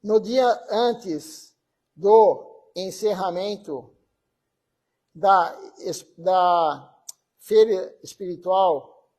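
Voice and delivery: slow, deliberate reading with long pauses between phrases; formal devotional tribute reading; solemn reading aloud. Acted. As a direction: read it out measured, phrase by phrase. Reads a tribute text aloud.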